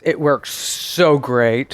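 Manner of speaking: very slow, drawn-out sarcastic praise. Real, not acted. Co-host plugging the phone she gives away, sarcastic. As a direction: stretch out the praise with heavy sarcasm. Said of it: "I sense some sarcasm there".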